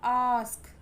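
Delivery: "आस्क" with a drawn-out, falling aah; emphatic, stretched "आस्क"; long A sound. Shown as a demonstration. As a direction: hold the opening vowel of "आस्क". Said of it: "long sound है ये".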